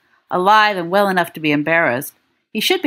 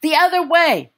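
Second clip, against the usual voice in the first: yell; 10 semitones higher than usual. A narrator's reading. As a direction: shout the correction across the noise. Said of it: "James t-, yells".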